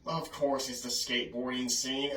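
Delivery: ordinary talk, nothing marked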